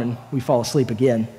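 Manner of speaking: normal talking voice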